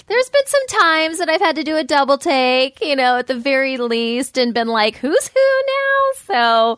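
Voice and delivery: puzzled mom asking who's who; a hint of a baffled mother; confused parent voice. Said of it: "we can kind of be like".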